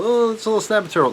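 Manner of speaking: very high, quick, mocking a doubter; mock dismissive voice. Mocking someone who thinks the turtles are small, high quick voice. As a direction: brush it off in a silly high voice. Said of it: "not little".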